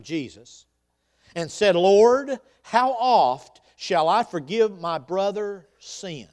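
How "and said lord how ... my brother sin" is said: loud, slow, emphatic reading; dramatic scripture reading. Acted. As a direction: proclaim each word slowly. Scripture read with force.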